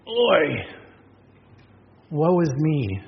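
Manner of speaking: an understated wail of woe; passionate cry of grief. Retold or imitated. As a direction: cry out oi in grief, held back a little. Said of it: "It's the passionate cry of grief".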